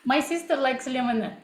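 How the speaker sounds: "lemonade" said the Russian way, limonad; Russian accent. Shown as a demonstration. Teacher repeating the test sentence for viewers, Russian accent. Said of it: "I was also speaking in this way".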